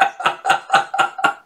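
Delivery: real unvoiced laughter; breathy laugh. Real, not acted. Cracks up after the robot voice.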